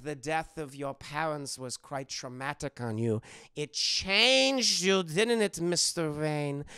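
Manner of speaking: hammy German therapist pressing hard; mock German doctor, building emphasis; Hugo Strange. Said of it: "a very thick German accent".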